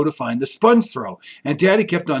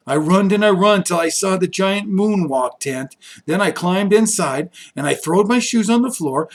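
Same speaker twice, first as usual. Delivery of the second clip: plain reading voice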